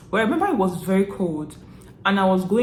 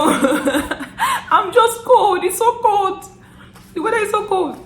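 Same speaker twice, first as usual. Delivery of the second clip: very high, mock-wailing through laughter; laughing protest; 13 semitones higher, 5 dB louder, slower than usual. Teary student explaining the tears to labmates, high laughing wail. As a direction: wail it loudly while half laughing. Acts out tearful excuse, laughing.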